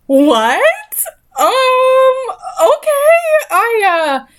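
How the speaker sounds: high startled disbelief; shocked. Real, not acted. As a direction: react in real shock. Shocked reaction.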